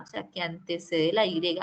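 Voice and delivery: ordinary delivery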